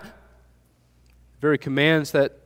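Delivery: ordinary talk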